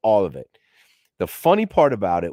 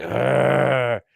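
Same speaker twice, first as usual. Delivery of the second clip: mock dog growl; 5 semitones higher than usual. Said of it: "he's like".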